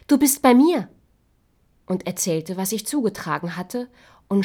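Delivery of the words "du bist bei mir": higher and louder, full of joy; joyful prince. Acted. Prince greeting the awakened girl, joyful and loud. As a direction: tell her gladly. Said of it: "sagte voller Freude".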